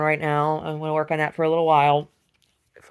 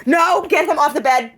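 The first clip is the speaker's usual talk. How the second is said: get him off; 12 semitones higher, 11 dB louder, slower than usual. Real, not acted. Telling someone to move the dog.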